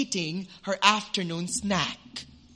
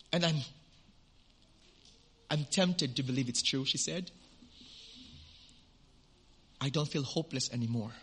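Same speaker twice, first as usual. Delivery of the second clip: hushed tearful woman; 4 semitones lower, 6 dB quieter than usual. Retold or imitated.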